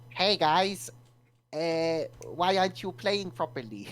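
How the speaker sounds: silly mock Scandinavian accent; stupid little Scandinavian voice. Podcaster mocks Scandi players scolding teammates, stupid little Scandinavian voice. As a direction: imitate a Scandi gamer complaining in a little voice. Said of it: "in their stupid little fucking voice".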